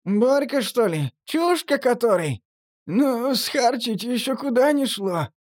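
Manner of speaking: high, nasal, giggling junkie; nasal giggly voice. Acted. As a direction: snicker it through the nose. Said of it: "смешливо прогнусавил Торчок".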